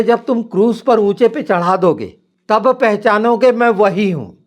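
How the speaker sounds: high, loud preaching of the scripture quote; proclaimed loudly. Retold or imitated. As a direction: proclaim the verse at full voice. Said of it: "यूहन्ना आठ अट्ठाईस बोले". (ऊँची, ज़ोरदार आवाज़ में वचन की घोषणा)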